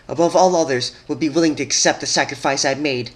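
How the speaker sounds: a betrayed princess scolding a former friend's pupil; Twilight's bitter rebuke. A narrator's reading. Twilight to Scootaloo, bitter grieving rebuke.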